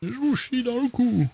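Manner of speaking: put-on very high, thin old-man voice; high-pitched doddering old codger; Grampa Simpson. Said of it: "parle comme le grand-père Simpson".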